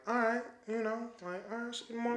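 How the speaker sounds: high, very soft retold reply; small, light voice, casually agreeing; acting out a quiet, easy goodbye; unbothered sign-off. Retells his unbothered sign-off to mom.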